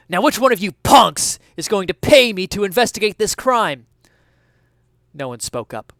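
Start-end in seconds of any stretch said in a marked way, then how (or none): 0.1-3.9 raised cop voice. Acted. Detective demands payment.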